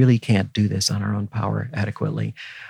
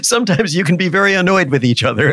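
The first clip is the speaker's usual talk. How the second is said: chuckling through the words, pitched up; laughing; 8 semitones higher, 3 dB louder than usual. Real, not acted. Professor laughing to host about married life, chuckling. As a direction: laugh while admitting it.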